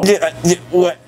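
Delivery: usual speaking voice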